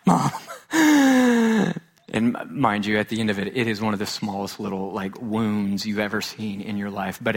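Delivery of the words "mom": put-on high, pleading child's call; a hurt kid whimpering for his mother; whiny call for mom. Boy hobbles to his mother, high pleading call.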